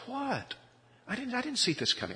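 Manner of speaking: high, fast astonished blurt; stunned disbelief. Retold or imitated. Preacher reacting to divorce call, high stunned disbelief. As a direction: caught completely off guard.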